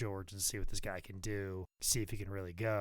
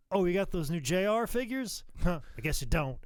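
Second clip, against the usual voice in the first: a light, higher mock Jim Ross; imitating Jim Ross; 7 semitones higher, 4 dB louder than usual. Jim Ross teasing him about figures, lightly imitated. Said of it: "Jim Ross will be like".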